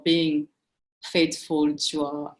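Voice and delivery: ordinary talk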